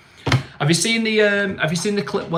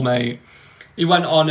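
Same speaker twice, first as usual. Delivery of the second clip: ordinary delivery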